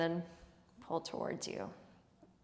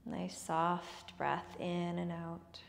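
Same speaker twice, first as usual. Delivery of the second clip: very slow, soft breathing cue; soft spa whisper; 9 dB quieter, much slower than usual. Shown as a demonstration. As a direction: draw the breath out gently and slowly. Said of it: "breathing cue, very slow, softer".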